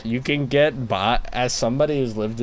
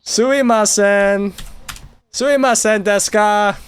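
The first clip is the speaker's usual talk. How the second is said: higher and louder, a polite yell of "Sumimasen"; 11 semitones higher, 6 dB louder than usual. Pretend. Mocking a foreigner who yells sumimasen, a loud polite shout. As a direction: blast "Sumimasen" at a Japanese stranger. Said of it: "really loud in every conversation".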